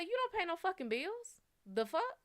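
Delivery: retold angry scoff at his order; angry retort. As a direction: throw it back at him, indignant.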